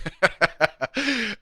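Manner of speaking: high, rapid real laugh; burst of laughter. Real, not acted. Host amused by a viewer's question, laughing.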